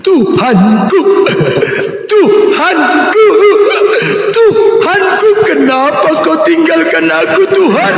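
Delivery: high, slow, drawn-out wailing; hammed-up cry of a forsaken man; mock anguished cry. Mocking Jesus calling out on the cross, high wailing. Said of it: "Tuhan memanggil Tuhan waktu disalib".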